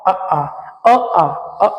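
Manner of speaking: अ short and आ long, from the throat; vowel demo. Shown as a demonstration. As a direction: alternate अ and आ, short then long. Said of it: "आ जो pronunciation होता है".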